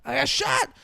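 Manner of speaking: high, loud hammed-up panic; loud high frantic mock scream; mock panicked shout. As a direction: yell it like he's been hit. Robber thinks he's shot.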